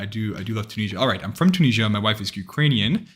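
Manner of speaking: usual speaking voice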